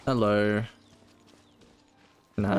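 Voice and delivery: ordinary talk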